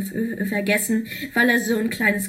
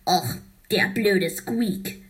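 annoyed; 3 semitones lower than usual. Acted. Karl losing the vote, mild low grumble. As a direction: mutter it, understated annoyance. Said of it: "sagte Karl verärgert".

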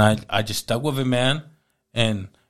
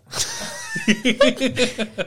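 burst of laughter. Real, not acted. Laughs at his own punchline.

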